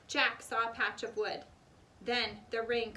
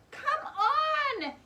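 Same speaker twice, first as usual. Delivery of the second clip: louder, much higher call; shout; 7 dB louder than usual. A narrator's reading. Annie shouts from the treehouse window, loud call. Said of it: "Annie was shouting".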